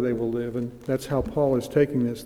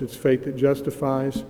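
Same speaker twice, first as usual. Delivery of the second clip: ordinary talk, nothing marked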